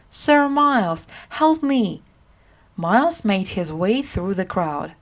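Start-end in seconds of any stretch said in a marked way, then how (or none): 0.1-2.0 crying for help. A narrator's reading. Edward begs Miles from the angry crowd, raised plea. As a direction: call out to your rescuer over the crowd. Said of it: "he cried".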